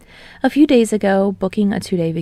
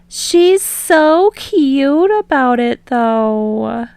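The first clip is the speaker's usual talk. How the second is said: higher, slow, delighted and louder; drawn-out fond coo; gushing; 6 semitones higher, 4 dB louder, much slower than usual. Acted. Ashido gushing to her bandmates about the hostess, high and slow.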